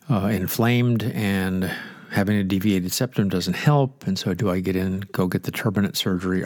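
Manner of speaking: normal talking voice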